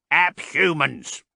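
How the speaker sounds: loud, slow indignant correction; angry. A narrator's reading. Huringer angrily correcting Haas, loud and offended. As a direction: snap back the word, offended. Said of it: "said Huringer angrily".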